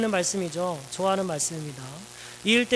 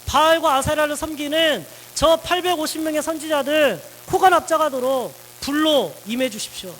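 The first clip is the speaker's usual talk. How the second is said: louder, higher insistent pleading; pleading demand; 6 semitones higher, 5 dB louder than usual. Acted. Elijah demanding fire from God, loud and insistent. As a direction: beg God like a stubborn child demanding proof. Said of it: "막 떼를 썼죠".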